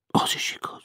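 soft, dejected half-whisper; sad agreement. A narrator's reading. Felix sadly giving in to the woman's objection. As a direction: concede it mournfully, barely voiced. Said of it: "hagyta rá nagy búsan".

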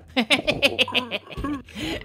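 high put-on giggling cackle; gloating cackle. Player taunting Grandpa about Rice Krispies, high gloating cackle. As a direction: cackle in mean glee.

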